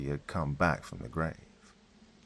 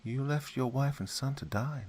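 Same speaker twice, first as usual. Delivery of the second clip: darker tone; 6 semitones higher, 3 dB quieter than usual. Acted. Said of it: "Her tone became much darker".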